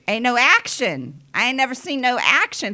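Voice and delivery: sassy loud "ain't never seen no action"; loud retort. Retold or imitated. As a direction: bark it like calling someone out. Calling out empty words.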